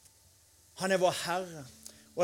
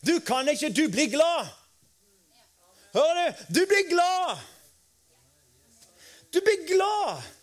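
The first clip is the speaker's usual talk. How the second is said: high, loud preacher's exhortation; fervent pulpit preaching, pitched up and loud; preaching at full force; 6 semitones higher, 5 dB louder than usual. Acted. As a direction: drive it home to the crowd, hammering each phrase.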